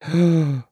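short startled gasp; high gasp to oneself. A narrator's reading. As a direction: gasp in dismay. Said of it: "ахнул про себя Коротков".

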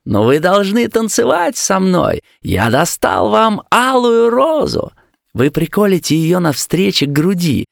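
high-pitched eager call; excited cry. A narrator's reading. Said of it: "закричал ей".

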